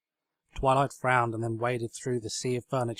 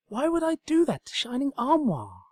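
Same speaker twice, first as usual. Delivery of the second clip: protesting; 3 dB louder than usual. Acted. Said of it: "protested Princess Credenza".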